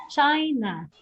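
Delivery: "China" with CHI stressed; stress on first syllable. Shown as a demonstration. Teacher adding another two-syllable noun, first-syllable stress. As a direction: hit CHI in "China", then let it fall. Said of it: "impact is on the first syllable".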